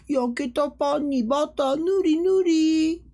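high, slow singsong; cartoon character voice. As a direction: mimic a small anime character savouring each word. Acting out a cartoon character's line.

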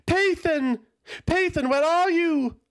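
high, loud desperate calls; calling out. Acted. Aeltha landing at the tent, calling loudly for Pathan. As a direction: shout for them across the camp. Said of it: "Aeltha did not have the time to care".

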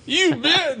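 squeaky, scandalized shout; high-pitched mock outrage. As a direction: shriek it like an offended clerk. Clerk's shocked retort, retold.

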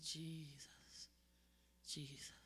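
whispered, faint and breathless; dying whisper. Retold or imitated. Dying patient calling Jesus, whispered. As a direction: breathe the name out like a dying man. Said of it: "and he said".

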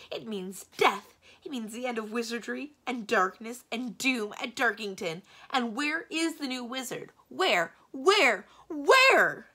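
loud, high, melodramatic ranting; distraught outburst. A narrator's reading. Magician laments old age to his secretary, distraught shouting. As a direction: rant in despair, hammering each disaster.

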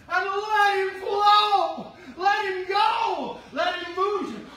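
slow, loud, high drawn-out exhortation; preaching at full force. Acted. Pastor calling the church to let the Spirit move, slow shout. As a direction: stretch each word out as a shouted call.

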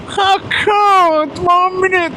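high mock voice of a dim customer. Courier mimicking the customer finally getting it, high mock shout. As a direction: ham up the slow customer.

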